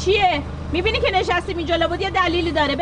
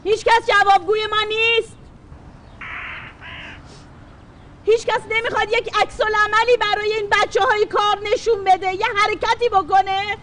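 raised, higher-pitched public complaint; protest outcry; 4 semitones higher than usual. Acted. Protester demanding action for working children, crying out. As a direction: call out to whoever will listen. Sit-in protester calling out to officials.

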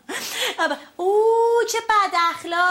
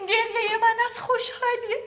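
tearful laughter; 5 semitones higher than usual. Acted. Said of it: "حالا گریه نکنی".

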